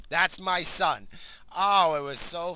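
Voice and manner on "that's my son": drawn-out, slow and loud proud line; slow proud cheer. Retold or imitated. Dad savouring his son's prank, slow and proud. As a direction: savour each word with pride.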